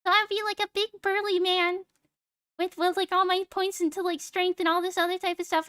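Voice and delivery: high cutesy uwu voice; sweet squeaky anime voice. Shown as a demonstration. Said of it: "I would do the Uwu voice".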